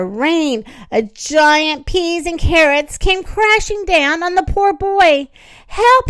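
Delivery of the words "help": high, loud yelp; shouted cry for help. A narrator's reading. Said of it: "he shouted".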